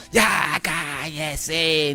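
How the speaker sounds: a slow, stern snap of a father telling his son to shut up; harsh hush. Retold or imitated. Father shows the harsh way he does not hush his son, a stern shout. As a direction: bark it at the child, drawn out. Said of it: "Y no le digo".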